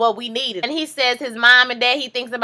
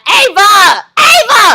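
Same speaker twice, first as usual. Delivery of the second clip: high, loud call of Ava; shouting a name; 9 semitones higher, 11 dB louder, slower than usual. Real, not acted. Vlogger yelling at a character on screen, loud call. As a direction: yell the name out in excitement.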